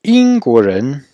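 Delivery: Yingguozhen said very slowly, each Chinese tone clear; Yingguozhen, carefully articulated; slow model pronunciation. Shown as a demonstration. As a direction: model Yingguozhen for students to repeat.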